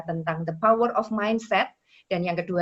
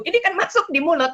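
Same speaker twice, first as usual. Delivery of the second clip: much higher, mock angry scolding, louder at the end; hammed-up indignant officer, high pitched; imitating angry officer; 10 semitones higher, 5 dB louder than usual. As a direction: lecture the traveller like the cross customs lady, high. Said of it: "Dia marah".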